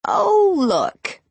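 high snickering witch voice; witchy snicker. A narrator's reading. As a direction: sneer it like a spiteful witch. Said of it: "snickered a familiar, annoying, witchy voice".

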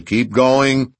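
loud, high, one-note order; monotone shout. Acted. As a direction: repeat it like a machine, loud. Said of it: "he repeated in a monotone".

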